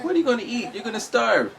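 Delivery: high, mocking voice of a baffled friend. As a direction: squeak it out like a worried friend teasing. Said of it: "You're like".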